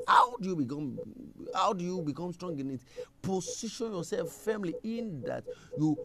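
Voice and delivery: loud, high preaching, slowing on "Be strong in it"; fiery preaching. Acted. Preacher urging the church to be strong, fiery. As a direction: drive the point home from the pulpit.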